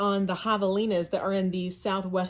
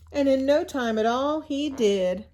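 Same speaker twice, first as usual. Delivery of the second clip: lively read-aloud storyteller voice, a bit louder; storybook narration; 5 semitones higher, 3 dB louder than usual. A narrator's reading. Teacher reading a picture book to her class, in lively storybook narration.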